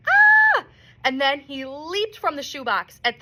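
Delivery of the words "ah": piercing high sustained shriek; squirrel scream. A narrator's reading. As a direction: shriek right back at her. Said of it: "Ulysses screamed in return".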